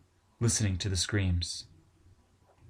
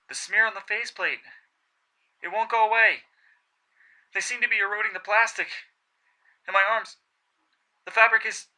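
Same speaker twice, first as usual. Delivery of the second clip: high, strained and louder, fear building; rising panic; 13 semitones higher, 4 dB louder than usual. Acted. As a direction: voice climbing as panic takes hold. Edmund panicking in the sea.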